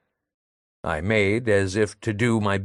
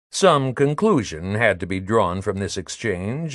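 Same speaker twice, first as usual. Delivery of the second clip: ordinary talk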